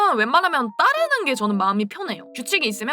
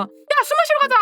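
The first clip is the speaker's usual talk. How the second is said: high, loud call to go drinking; newly adult friend shouting to go drink, high and loud; excited shout; 4 dB louder than usual. Retold or imitated. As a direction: yell it to your friends, giddy. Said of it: "하면서 막".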